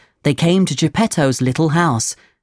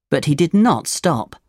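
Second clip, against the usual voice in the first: ordinary delivery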